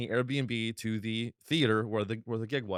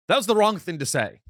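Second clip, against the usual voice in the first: ordinary talk, nothing marked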